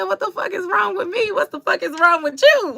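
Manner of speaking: retelling Della's comeback from Harlem Nights, high mock fury. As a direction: fling the question back like a brawling woman. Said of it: "And she said".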